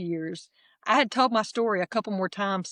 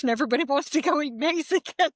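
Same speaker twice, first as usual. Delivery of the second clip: ordinary talk, nothing marked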